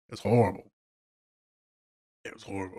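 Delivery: very soft, drained aside; subdued horror. Real, not acted. Viewer remembering a film too painful to watch, hushed. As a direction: let it drop almost to nothing. Recalls distressing film, overcome.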